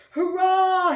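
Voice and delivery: loud, high hurrah; triumphant shout. Acted. Pig escaping the crocodile, a loud triumphant cheer. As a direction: cheer in victory. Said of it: "he shouted".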